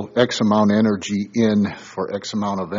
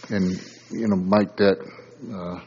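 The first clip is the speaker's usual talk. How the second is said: normal talking voice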